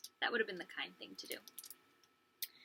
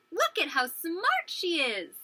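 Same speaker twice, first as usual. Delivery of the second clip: higher, drawn-out boast; proud exclamation; 6 semitones higher, 3 dB louder, slower than usual. Acted. Sister showing off her clever hen, proud exclamation. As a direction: show her off with delighted pride. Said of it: "Amy was so proud".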